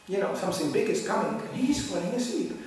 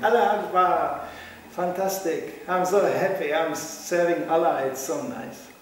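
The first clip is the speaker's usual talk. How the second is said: louder, slow joyful exclaim; mock cheerful joy; 2 semitones higher, 4 dB louder, slower than usual. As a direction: ham up a happy believer. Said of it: "walking up in the morning and going".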